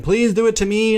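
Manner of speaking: retold father pleading; mock desperate plea. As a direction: beg to take her place. Husband begging to take the shot.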